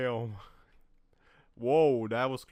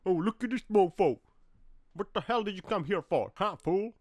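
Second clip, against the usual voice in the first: higher, louder sneering challenge; hammed-up indignant tough talk; mock tough guy; 8 semitones higher, 5 dB louder than usual. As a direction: taunt the driver like a street bully. Voicing a driver mockingly.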